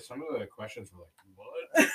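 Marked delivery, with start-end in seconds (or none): 1.3-1.9 surprised. Retold or imitated. Quoting confusion at quiz question.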